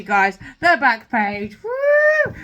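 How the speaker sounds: loud, slow excited call then a high whoop; gushing loudly to viewers. Real, not acted. Showing viewers an old book, thrilled.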